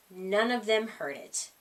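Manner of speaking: plain reading voice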